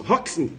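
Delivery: "Roxin" as HOK-sin; correct pronunciation. Shown as a demonstration. Teacher repeats the name for students, correct pronunciation. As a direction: say "Roxin" with ks, not sh. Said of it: "Fala assim, ó".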